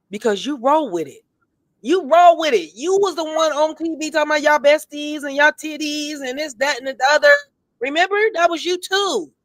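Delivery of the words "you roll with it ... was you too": higher, pointed callout; calling her out. Real, not acted. Host calling out a reality star on air, pointed and higher. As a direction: raise the pitch and accuse her.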